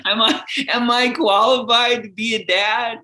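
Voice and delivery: a fretting mock panic; anxious new dad. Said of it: "I was completely terrified".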